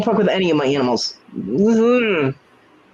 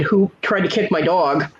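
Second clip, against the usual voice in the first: usual speaking voice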